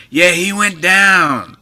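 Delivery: slow, high and pleased with it; Johnny's slow high voice. Retold or imitated. Telling the story of the fight, slow and drawn out. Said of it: "And he goes".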